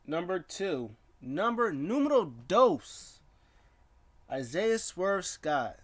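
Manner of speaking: high, stretched-out ring announcer call; playful announcer. Pretend. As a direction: draw out each name with big swoops. Announces the next match.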